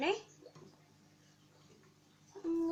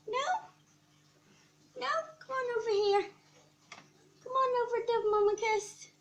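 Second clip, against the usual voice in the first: higher, louder coaxing pet talk; 6 semitones higher, 4 dB louder, faster than usual. Real, not acted. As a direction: sweet-talk a little pet over. Coaxing pet to come kiss.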